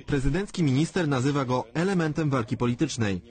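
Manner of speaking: normal talking voice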